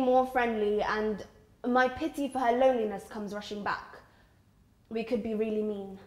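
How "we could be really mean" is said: plain reading voice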